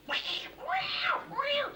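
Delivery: high whispered cry for help; tiny voice crying help. Retold or imitated. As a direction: squeak out help like a trapped cat. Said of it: "you're doing all this sort of".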